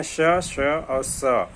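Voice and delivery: श, श और स, each hiss drawn out very slowly and apart; sibilants sounded out. Shown as a demonstration. Teacher sounding out the sibilants for the class, slow and drawn out. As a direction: stretch out श, श and स one by one, slowly. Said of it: "और श जो होते हैं".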